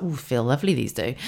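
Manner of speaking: soft, near-silent coo of pleasure; soft contented coo. Retold or imitated. Shuffling the cards, murmuring softly with pleasure. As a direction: murmur it as if lost in the rhythm.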